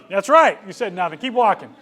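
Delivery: put-on loud, firm parent putting the kid in place; stern parent. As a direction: call it out with mock authority. Parent answering kid firmly.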